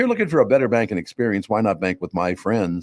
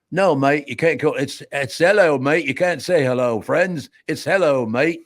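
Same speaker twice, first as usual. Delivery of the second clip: mock English accent, a bit higher; 6 semitones higher than usual. Pretend ad actor in an English accent corrects a diner. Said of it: "speak with an English accent".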